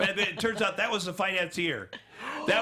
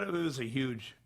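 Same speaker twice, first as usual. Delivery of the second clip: ordinary delivery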